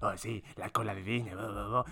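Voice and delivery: low, soft dismissive mimicry; mocking imitation. Imitating a friend joking about the Disney queues, mocking. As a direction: quietly parody a friend brushing it off. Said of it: "Y vos me dijiste".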